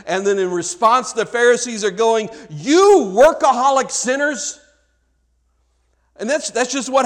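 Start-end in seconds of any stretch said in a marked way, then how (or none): 2.5-4.6 higher, louder, slow mocking scold; hammed-up accusing sneer; mock Pharisee scolding. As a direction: lampoon a self-righteous Pharisee, drawn out.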